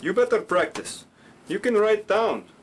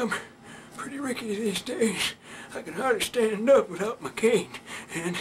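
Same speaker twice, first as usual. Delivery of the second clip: put-on shaky, higher-pitched old man; rickety elder pausing to catch his breath; Pops; 4 semitones higher than usual. Shown as a demonstration. Old puppet grumbling about his cane and dirty clothes, shaky and winded. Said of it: "the accent like Pops, you know, he's older".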